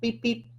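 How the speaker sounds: high soft beep beep, drifting away; bus horn fading. Pretend. Stress bus driving off, faint high horn. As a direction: honk quietly as if the bus is far off. Bus leaving, honking goodbye.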